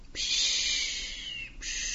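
gehauchtes Meeresrauschen nachahmen; geflüstertes Wellenrauschen. Acted. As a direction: rausche wie Wellen, tonlos. Wellengeräusch nachgeahmt. (nachgeahmtes Wellenrauschen, gehaucht)

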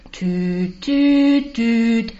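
lautes, hohes Tüt; nachgeahmtes Piepen. Acted. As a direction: ahme den Piepton nach. Piepen der Maschine nachgeahmt. (nachgeahmtes Piepen)

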